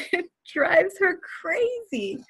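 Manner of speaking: laughing at how daughter reacts. Real, not acted. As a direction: giggle it out slowly.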